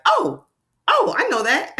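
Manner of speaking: high bright oh of discovery; like suddenly getting it; excited realization. Acted. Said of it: "It just says".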